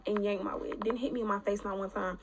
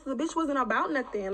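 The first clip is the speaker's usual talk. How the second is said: ordinary delivery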